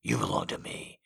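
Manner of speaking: low, soft, possessive growl. Acted. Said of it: "he growled".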